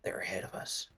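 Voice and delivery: hushed, urgent whisper. Acted. Todd warning the party they're ahead, whispering. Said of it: "Todd whispers".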